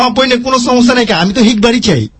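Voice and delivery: Dhakaiya dialect, h for sh; Old Dhaka accent. Acted. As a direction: talk like an Old Dhaka local. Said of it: "আমিও ঢাকার ভাষায় কথা বলতে পারি".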